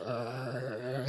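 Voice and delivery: low, soft zombie growl; zombie groan. Retold or imitated. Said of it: "Hindi yung zombie na parang". (mahinang ungol ng zombie)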